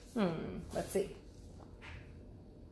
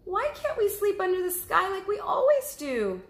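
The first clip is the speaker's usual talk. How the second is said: high, small cub voice; little cub asking; 13 semitones higher, 5 dB louder than usual. Acted. Cub asking mother about sleeping outside, high little voice. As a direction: ask like a curious little bear cub. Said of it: "he asked".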